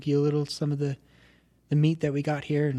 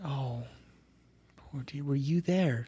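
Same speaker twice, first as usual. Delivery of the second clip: hushed consoling; 5 dB quieter, slower than usual. Acted. Mom softly consoles.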